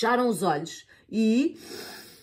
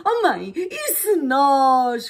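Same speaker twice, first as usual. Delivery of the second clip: eager idea; 6 semitones higher, 6 dB louder, much slower than usual. Acted. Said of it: "começou a Eva".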